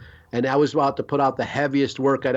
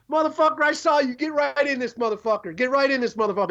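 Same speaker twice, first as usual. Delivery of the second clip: hyped doorman; 10 semitones higher than usual. Retold or imitated. Imitating the blues club doorman.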